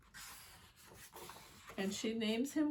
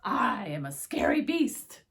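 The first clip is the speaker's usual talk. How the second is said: mock scary beast; 9 dB louder than usual. Wolf boasts in story.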